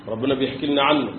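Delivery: usual speaking voice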